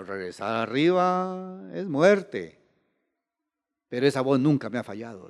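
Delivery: softly voiced inner doubt; hushed, grave quote. Preacher retelling the man facing the deadly climb, hushed. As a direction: drop the volume, as if weighing the danger.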